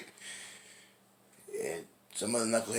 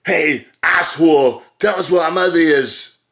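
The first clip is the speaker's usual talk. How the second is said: loud, slow, higher hammed-up bellow; mock furious shout; 8 semitones higher, 13 dB louder than usual. Retelling Twins, Julius kicks the door in, mock furious shout. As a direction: bark it like a movie tough guy kicking in a door. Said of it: "he kicks the door in".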